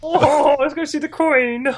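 high silly posh English parody; mock posh English. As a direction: squeal like a royal-mad English toff. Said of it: "We don't actually speak like that".

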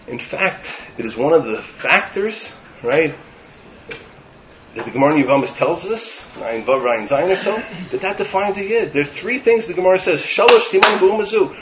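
ordinary delivery

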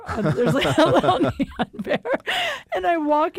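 words breaking up with real laughter; laughing. Real, not acted. Storyteller describing Travelodge's neon bear, laughing. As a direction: crack up while trying to say it.

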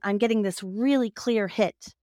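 usual speaking voice

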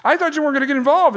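put-on high-pitched, incredulous kid complaining to dad; wounded kid's outcry, very high; upset protest. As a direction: squeal it at dad in disbelief, pitch way up. Upset kid questions his dad.

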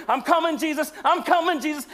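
high, loud hammed-up cry; eager comic Lazarus. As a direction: play an excited man hurrying to answer a call. Lazarus answers, hopping from the grave.

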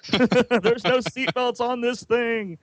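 high, drawn-out comic wail; mock panic. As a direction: wail it slowly in hammed-up alarm. Characters panic without seatbelts.

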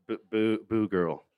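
awkward mumble; reluctant repeat. Retold or imitated. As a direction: say it half-hearted and embarrassed. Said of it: "I'm like".

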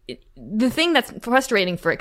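ordinary delivery

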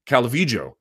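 Calvillo with the double L as an English J. Shown as a demonstration. Defending his way of saying her name, J-sound Calvillo. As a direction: say Calvillo as "cal-VEE-jo". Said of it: "I'm not telling you she has to say it".

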